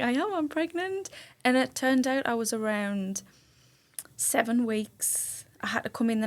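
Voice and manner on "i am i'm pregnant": 1 excited announcement. Retold or imitated. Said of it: "And I was like".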